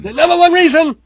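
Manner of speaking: high, loud announcer echo; mock announcer echo. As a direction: call it out like a hype man. Echoes the countdown announcement loudly.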